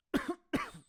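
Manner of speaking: harsh chesty cough; real hacking cough; coughing fit. Real, not acted. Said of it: "I'm coughing a lot".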